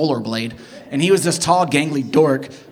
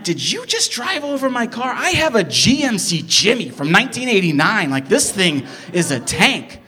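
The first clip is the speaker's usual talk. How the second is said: outraged disbelief; 7 semitones higher than usual. Retold or imitated. Outraged at driver hitting car.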